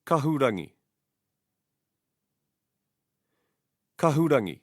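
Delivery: "Kahurangi" stressed on KA; stress first syllable. Shown as a demonstration. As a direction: say "Kahurangi" as KA-hu-ra-ngi. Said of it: "stress the first syllable of the word".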